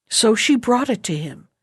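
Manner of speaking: ordinary delivery, nothing marked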